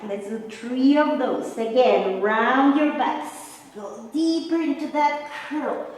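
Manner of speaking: usual speaking voice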